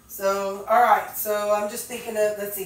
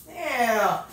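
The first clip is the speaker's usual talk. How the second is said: ordinary talk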